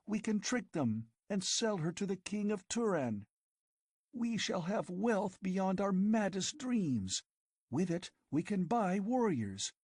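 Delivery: put-on girl tempting her lover with an empire; passionate scheming girl. A narrator's reading. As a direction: sell him the dream with burning eagerness. Girl's scheming speech.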